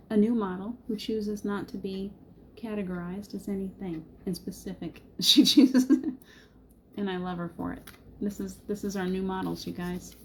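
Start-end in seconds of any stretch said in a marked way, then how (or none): 5.1-5.9 bursting out in a loud laugh; laughing loudly. Real, not acted. Proud mother laughing about her child, loud burst of laughter. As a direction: laugh it out, delighted.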